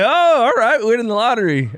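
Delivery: high, sarcastic oh all right; unimpressed mock reaction. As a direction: act underwhelmed by the lottery win. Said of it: "I was like".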